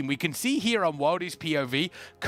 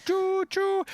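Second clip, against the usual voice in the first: playful choo choo. Pretend. Imitating train.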